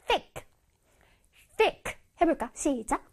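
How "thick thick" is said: "thick" said short and isolated; pronunciation demo. Shown as a demonstration. Teacher showing how "thick" is pronounced, short and separate. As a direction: give "thick" as one crisp word. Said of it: "thick 발음 다시 알려줄게".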